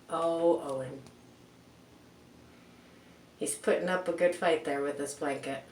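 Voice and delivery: ordinary delivery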